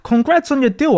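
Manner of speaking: ordinary talk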